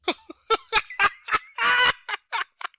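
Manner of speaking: high, loud mock cackle; imitated laughing fit. Chunk laughs at the drawn guns, imitated high cackle. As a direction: burst into a long, high-pitched laugh, imitating him. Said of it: "because he starts laughing".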